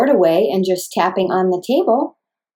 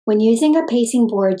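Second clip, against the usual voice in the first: ordinary talk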